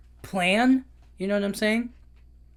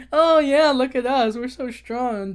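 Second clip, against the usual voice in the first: high, loud mock boasting; showing off; 4 semitones higher, 4 dB louder than usual. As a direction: puff up like a fake tough guy. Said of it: "showing off".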